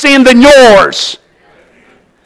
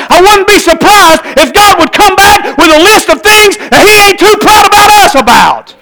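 fiery preaching; 7 semitones higher than usual. Acted. Pastor urging church to pray, rising preaching. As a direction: build to a preaching peak.

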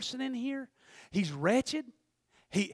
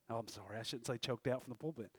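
sheepish aside; 11 semitones lower, 16 dB quieter, faster than usual. Real, not acted. Apologetic aside to congregation.

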